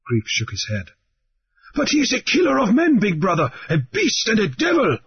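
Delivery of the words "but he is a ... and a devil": high, urgent protest; protesting goat man. Acted. The goat man warns big brother about the killer, high protest. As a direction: plead against him, high and insistent. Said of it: "the goat man protested".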